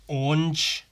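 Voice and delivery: Orange squashed toward one syllable, "ornj"; American pronunciation. Shown as a demonstration. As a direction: drop the middle vowel in Orange. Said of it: "without the A".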